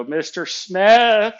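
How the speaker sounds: loud, high, drawn-out name; sharp correction. Retold or imitated. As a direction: cut him off with a stretched, forceful title. Corrects boss calling him Mike.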